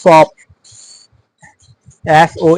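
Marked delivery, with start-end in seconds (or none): 0.0-0.3 "শপ" with a drawn-out o vowel; long o. Shown as a demonstration. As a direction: model "শপ" as soap, holding the o long. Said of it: "ও এর মতো লম্বা উচ্চারণ হবে".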